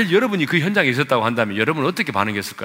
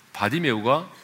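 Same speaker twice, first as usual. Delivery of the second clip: ordinary talk, nothing marked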